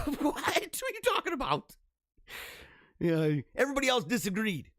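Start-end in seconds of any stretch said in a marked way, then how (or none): 0.0-1.8 high, squeaky mock outrage; mock disbelief. Retelling a friend's remark, he squeaks back in mock disbelief.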